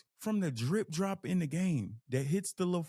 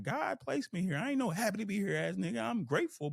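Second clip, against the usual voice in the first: higher, firm declaration of faith; true inner voice; 6 semitones higher than usual. Retold or imitated. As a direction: rise up and claim your place, higher than usual. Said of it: "my true voice".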